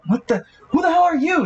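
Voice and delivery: high, indignant startled demand; startled outburst. Acted. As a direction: pitch leaping high in surprise. Villain startled by newcomers.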